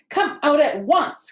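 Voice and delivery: loud high order; cried out. A narrator's reading. Mother crying out for Avery to come out. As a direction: snap the command. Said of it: "cried Missus Arable".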